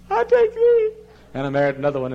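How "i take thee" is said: very high, loud imitated bridal wail; hammed-up tearful bride; mock crying vow. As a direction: squeal it as if bursting into tears. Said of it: "or because you cry".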